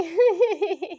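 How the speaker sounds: loud, high embarrassed laugh; giggling burst of laughter at her own accent; bashful laugh. Real, not acted.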